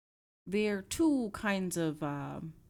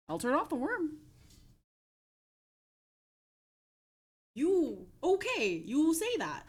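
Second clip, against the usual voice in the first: higher put-on voice; 7 semitones higher, 4 dB louder, slower than usual. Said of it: "trying to do random voices".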